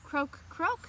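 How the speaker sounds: a light frog croak for story time. Acted. Frog voiced in picture book.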